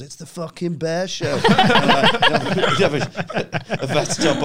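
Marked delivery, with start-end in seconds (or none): 0.0-1.4 bear making entrance. Retold or imitated. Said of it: "this bear walks in".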